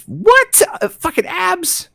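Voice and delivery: high, slow disbelief; incredulous. Real, not acted. Dad marvels at boy's abs, high and slow. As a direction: squeak it in disbelief.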